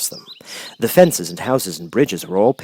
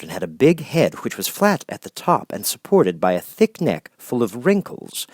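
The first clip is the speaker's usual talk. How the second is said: ordinary delivery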